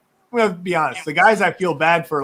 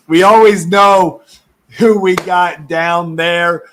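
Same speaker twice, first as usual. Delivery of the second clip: excited shout-out; 8 dB louder, slower than usual. Real, not acted. Shouting out a teammate.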